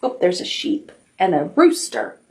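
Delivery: ordinary delivery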